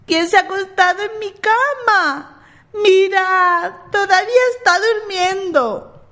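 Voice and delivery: put-on high, tiny baby bear voice; sweet little voice. A narrator's reading. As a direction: squeak it like an excited little cub. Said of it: "dijo con su voz dulce".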